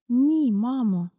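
slow, strained timid reply; barely uttered. A narrator's reading. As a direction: squeeze the words out, scared of mother. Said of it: "насилу вимовила міс Фенні". (насилу, повільно й боязко)

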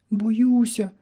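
put-on high-pitched frightened little girl; small high voice of a scared girl; timid little chirp. A narrator's reading. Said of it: "тихо цвірінькнула дівчинка".

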